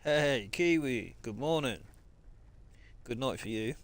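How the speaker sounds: ordinary talk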